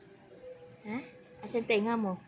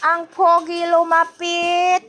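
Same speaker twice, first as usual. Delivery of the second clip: slow, higher and louder; slow, raised-voice fanfare for the pet; drawn-out announcing; 5 semitones higher, 6 dB louder, slower than usual. Pretend. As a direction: stretch each word out loudly.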